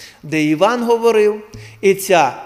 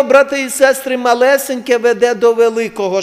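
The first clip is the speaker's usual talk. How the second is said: ordinary delivery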